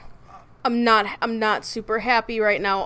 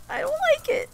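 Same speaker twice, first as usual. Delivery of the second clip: much higher, softer whiny squeak, "don't" stretched; 4 dB quieter, faster than usual. Real, not acted. Player repeats her worry about Naya as a high whimper.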